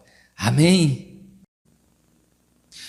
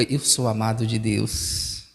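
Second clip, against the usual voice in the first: tender confession; 7 semitones lower, 5 dB quieter, slower than usual. Shown as a demonstration. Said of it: "Olhe para quem tá ao seu lado e diga".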